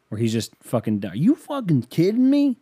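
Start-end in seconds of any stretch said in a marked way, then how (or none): 1.1-2.6 high, loud, incredulous outburst; mad radio host. Retold or imitated. Money host losing it at a caller, mock angry. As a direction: imitate an exasperated host blowing up. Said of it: "I love when he gets mad".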